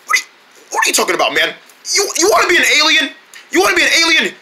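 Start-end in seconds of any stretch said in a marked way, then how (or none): 1.9-4.3 excited teasing. Acted. Friend teases gibberish speaker.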